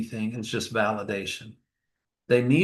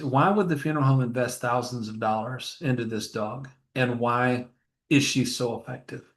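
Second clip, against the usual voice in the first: normal talking voice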